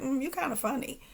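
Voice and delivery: side-eye funny. Retold or imitated. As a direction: imitate a grudging, side-eyed appraisal under your breath. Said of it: "the side-eye funny when somebody's like".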